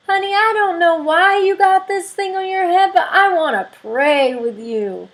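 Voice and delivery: high, loud, lightly voiced elderly churchwoman, slowing down; older churchwoman. Older lady at the yard sale telling the speaker she wants to pray. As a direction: call her honey and insist on praying.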